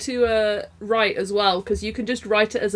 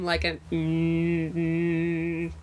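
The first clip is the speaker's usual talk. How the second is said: low slow hum; low drone; 5 semitones lower, 4 dB quieter, much slower than usual. Shown as a demonstration. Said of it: "a nice low drone".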